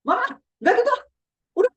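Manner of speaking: very high-pitched defiant protest; nyolot, talking back. Acted. Child interrupting nagging mother, high and defiant. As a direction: cut mama off with a high, testy retort. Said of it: "Dia jadi nyolot".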